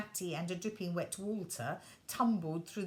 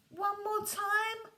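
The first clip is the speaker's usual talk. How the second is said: put-on high, slow little-boy plea; child begging for more jumping; pleading child; 11 semitones higher, 3 dB louder, slower than usual. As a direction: wheedle like a small boy. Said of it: "asked Walter".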